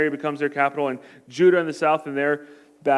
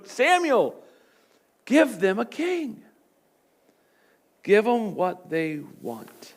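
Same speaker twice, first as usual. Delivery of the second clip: slow, calm voice of God; God's voice; 3 semitones higher, slower than usual. Retold or imitated. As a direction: say it slowly over his shoulder. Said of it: "God's coming over his shoulder".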